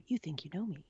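very soft, murmured song intro; hushed theme imitation. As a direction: drop right down, like an entrance theme's intro. Imitating a theme song's spoken intro.

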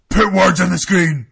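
loud promo yell; mock wrestler shout. Imagining Braun Strowman filming a promo, mock shout. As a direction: holler it like a wrestler's demand.

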